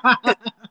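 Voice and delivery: short high laugh; quick, loud giggle. Real, not acted. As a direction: burst out laughing briefly. Laughs at own remark.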